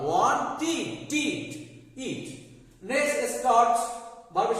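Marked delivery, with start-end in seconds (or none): 0.1-2.3 "wanted" as "won-ti", then টিড alone; syllable-by-syllable -tid. Shown as a demonstration. Teacher drills the right ending of "wanted", -tid in pieces. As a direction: break "wanted" down to its short-i ending. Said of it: "সঠিক উচ্চারণ হবে".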